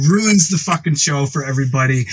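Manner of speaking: ordinary talk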